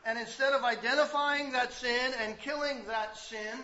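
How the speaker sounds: loud, slow, emphatic preaching; fiery pastor pressing the congregation; preaching at full force. Acted. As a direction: drive the point home from the pulpit.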